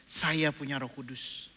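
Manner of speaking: slow, quiet repeat; slow confession again; slow declaration. Shown as a demonstration. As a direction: savor each word.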